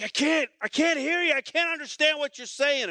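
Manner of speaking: high, loud feigned deafness; can't hear you. Retold or imitated. Pastor faking he can't hear his friend in the shower, loud. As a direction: shout like the water drowns him out. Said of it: "I'm like".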